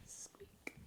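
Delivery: tiny whispered squeak. Shown as a demonstration. Mother whispers the shoe squeak back. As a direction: breathe the squeak out softly.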